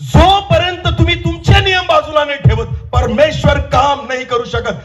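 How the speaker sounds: high, emphatic preacher's cry; impassioned preaching. Real, not acted. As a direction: declare it high, then begin to ease. Preaches at full force. (आवेशपूर्ण, चढ्या आवाजातली घोषणा)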